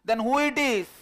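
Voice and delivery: loud high question; shouting from a distance, loud and high. Acted. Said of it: "he shouts".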